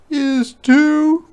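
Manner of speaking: high, loud, slow demand; a boy insisting on his camera; loud high boy's demand. Acted. Martin quarrel.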